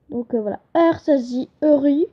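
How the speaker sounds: normal talking voice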